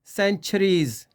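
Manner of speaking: "सेंचुरीज" slowly with an aspirated ch; slow model word. Shown as a demonstration. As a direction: say "सेंचुरीज" slowly with a breathy chh. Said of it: "छे की आवाज़ निकालेंगे".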